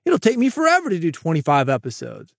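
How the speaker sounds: mock-shocked listener balking at the workload; mock disbelief. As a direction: ham up an outraged skeptic. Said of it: "People say".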